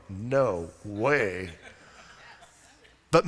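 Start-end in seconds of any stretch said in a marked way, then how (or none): 0.1-1.5 low, drawn-out "no way"; imagined refusal. Acted. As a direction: voice a congregant's refusal, low and soft. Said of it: "Some of you are going".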